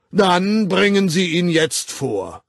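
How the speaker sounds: slow, lofty command; haughty demand. Acted. Director demanding the proof with haughty slowness. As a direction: order it with stiff dignity. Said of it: "unverändert hoheitsvoll verlangte".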